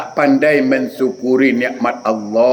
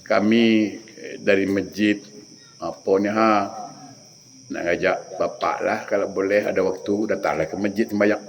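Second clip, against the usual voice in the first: much softer, lower, timid pleading; meek invitation; 9 semitones lower, 8 dB quieter than usual. Retold or imitated. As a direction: speak small and humble at the door. Pendakwah mengajak dengan rendah diri. (ajakan lembut dan perlahan)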